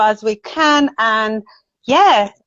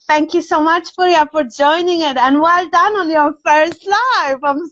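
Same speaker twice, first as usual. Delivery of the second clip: high-pitched, delighted congratulations; really happy; 6 semitones higher than usual. Real, not acted. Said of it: "I'm so, so happy".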